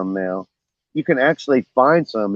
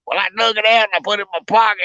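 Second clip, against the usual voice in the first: higher, fast imitation of the old buddy; buddy's voice; 10 semitones higher, faster than usual. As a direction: rattle it off high as the old fisherman. Buddy voiced in boat story.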